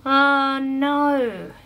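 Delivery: high, drawn-out mock dismay; voice from afar. A narrator's reading. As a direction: call it across a big room. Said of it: "came a voice from the opposite side".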